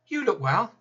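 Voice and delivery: high, bright mock-cheery voice; sing-song false cheer, pitched high; chirpy visitor's greeting. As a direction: parrot the well-meaning small talk.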